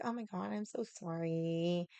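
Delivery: lightly voiced girly apology; such a girl. Girl apologizing at the bar, lightly mimicked girly voice. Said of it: "like, such a girl".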